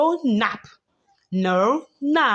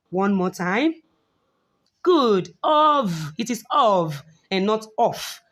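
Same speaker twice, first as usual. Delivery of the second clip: ordinary talk, nothing marked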